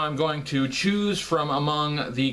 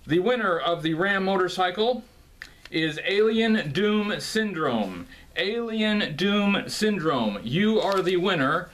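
hyped giveaway announcement; 3 semitones higher than usual. Acted. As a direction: announce the winner like a game show host.

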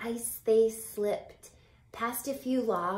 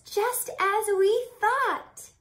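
much higher, louder thrilled see; excited call; 12 semitones higher, 6 dB louder, slower than usual. Acted. Charles shows Boggin the tree, thrilled high call. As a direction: burst out with joy.

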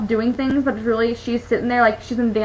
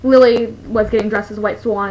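normal talking voice

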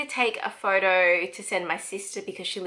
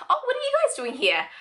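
high, quick surprised greeting; high fast mock surprise; surprised friend; 3 dB louder, faster than usual. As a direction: sound caught off guard. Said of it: "Brett's like".

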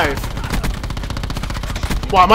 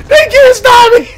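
laughing triumph shout; 13 semitones higher than usual. Real, not acted. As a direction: cackle with glee at the win.

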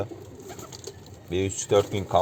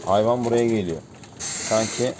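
ordinary talk, nothing marked

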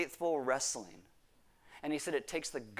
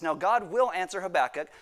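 ordinary talk

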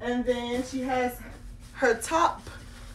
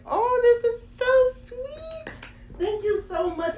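squealing delight; 11 semitones higher than usual. Real, not acted. Thrilled by little dress.

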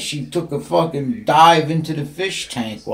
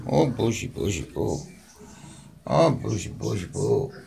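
low soft fading coo; 4 semitones lower, 4 dB quieter than usual. Real, not acted. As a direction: murmur sweet nonsense. Cooing nonsense to cat.

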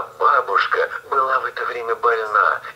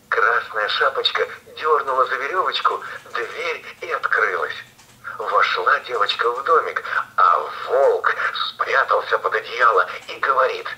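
ordinary delivery

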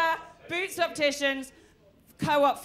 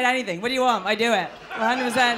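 ordinary talk

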